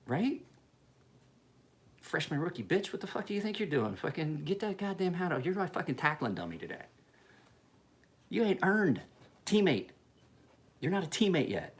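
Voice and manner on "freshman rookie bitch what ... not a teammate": higher-pitched mock drill-sergeant yell at a rookie; hammed-up locker-room chewing out; mock coach tirade. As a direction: bark at the freshman like a hazing senior.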